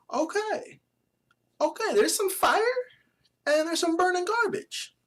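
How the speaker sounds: high, squealed hype voice; voice cracking. Real, not acted. YouTuber sorting hot jerseys from garbage, voice cracking high. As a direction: push it up till it squeaks. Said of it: "is making me voice crack".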